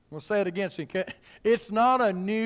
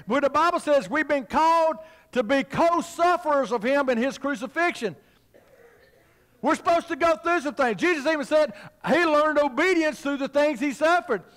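higher-pitched, driving sermon delivery; forceful preaching; 6 semitones higher than usual. Real, not acted. Preacher telling the church about suffering with Christ, forceful preaching. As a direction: preach it hard.

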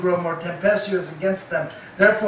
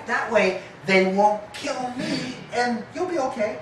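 sneaky Abraham; 6 semitones higher than usual. Acted. Abraham voiced scheming.

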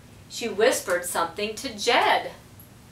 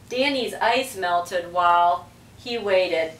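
ordinary delivery, nothing marked

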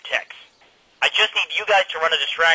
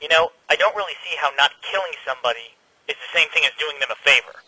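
ordinary delivery

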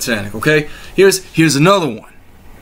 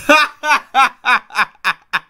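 triumphant laugh; 8 semitones higher than usual. Real, not acted.

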